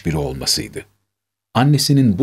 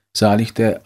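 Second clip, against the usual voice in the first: usual speaking voice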